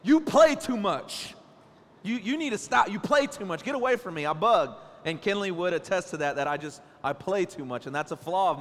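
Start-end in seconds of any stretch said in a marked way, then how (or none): 0.0-4.7 higher, louder nagging wife; a mock-exasperated wife telling him off, pitched up; imitating wife scolding. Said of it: "she repeats it almost every day".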